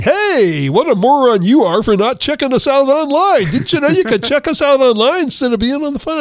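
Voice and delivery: a hint of a snide phone recording; mocking recorded voice. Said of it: "the recording is saying".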